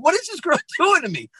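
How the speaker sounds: high, overwhelmed wail; mock helplessness. Dad manipulated by his daughter, helplessly overwhelmed. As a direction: plead helplessly, pitch leaping up.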